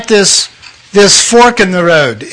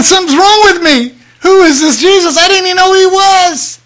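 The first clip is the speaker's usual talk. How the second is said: high, anxious and bewildered; frightened teen asking what's happening; alarmed; 11 semitones higher than usual. Retold or imitated. Teen boy scared by a name in his head, high voice. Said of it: "I'm going".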